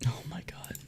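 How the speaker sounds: awed whisper. Real, not acted. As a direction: breathe it out in disbelief. Whispers in disbelief at result.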